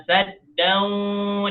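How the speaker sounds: SADDAN drawn out high, final n only half sounded; slow chant, humming vaguely at the end of SADDAN; blurred, hidden n. Shown as a demonstration. Teacher shows the in-between reading, veiled nasal n. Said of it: "dibaca dengan samar-samar".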